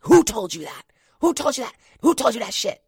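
high, loud, alarmed demanding; angry mom. Retold or imitated. Horrified mother interrogating her little son, loud and high. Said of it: "So she's like".

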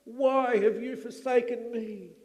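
loud, anguished cry to God; yelling. Acted. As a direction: yell it into the darkness. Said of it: "Jesus yells into the blackness".

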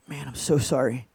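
low, hushed heartfelt apology; tearful sympathy. Retold or imitated. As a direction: apologize low, as if moved to tears. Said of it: "Now I'm crying".

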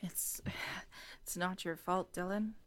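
very soft, hushed voice; subdued reassurance. Acted. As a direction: answer a friend gently and privately. Character quietly reassures apologising friend.

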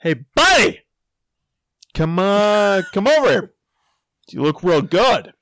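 high, loud holler to a buddy; mock yelling. Man doubling down on his supposed friend, mock yelling. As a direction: double down on the teasing call. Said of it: "I thought I was yelling to my friend".

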